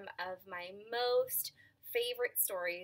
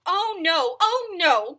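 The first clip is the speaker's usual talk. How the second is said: alarmed buffalo cry; 9 semitones higher, 14 dB louder, slower than usual. Acted. Buffalo cries out.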